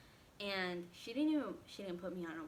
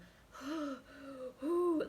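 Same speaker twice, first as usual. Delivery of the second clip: sharp panting gasps, higher and louder; gasping for breath; 6 semitones higher, 6 dB louder than usual. Retold or imitated. Reliving labor pains in the waiting room, gasping. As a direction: reenact heaving labor breaths. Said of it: "like, breathing so hard".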